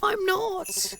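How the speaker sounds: high, slow, tearful begging to a ghost; high tearful pleading to Kazuki; pleading woman. Acted. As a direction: beg forgiveness with a high trembling voice. Protagonist denies.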